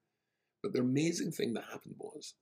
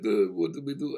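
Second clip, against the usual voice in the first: put-on Israeli accent; 9 dB louder than usual. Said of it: "and said".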